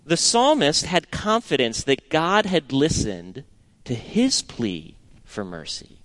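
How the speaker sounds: ordinary talk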